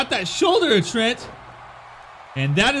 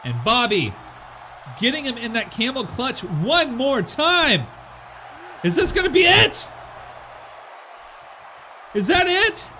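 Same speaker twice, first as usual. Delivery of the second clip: play-by-play wrestling call; wrestling commentator; 4 semitones lower than usual. Acted. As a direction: call the match like a ring announcer.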